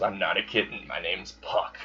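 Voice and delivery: a small sulk, dropping away at the end; mild pout. Retold or imitated. Puck objects to being called kitten, lightly pouting.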